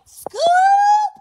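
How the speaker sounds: very high, loud rising Scoop; shouted gang call. Retold or imitated. New mother shouting the gang call after birth. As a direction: yell it like a rallying cry. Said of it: "she was like".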